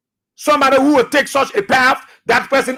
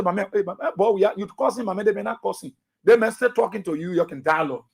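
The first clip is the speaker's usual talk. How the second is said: low, hushed, placating tone; soft voice; 4 semitones lower, 7 dB quieter than usual. Acted. Host acting out calming someone who curses, in a soft voice. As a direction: talk an angry man down softly. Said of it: "That soft voice that I use will take away wrath".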